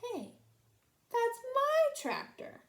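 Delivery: high, slow outraged realization; startled. A narrator's reading. Farmer Odell realizes it's his own tractor, startled.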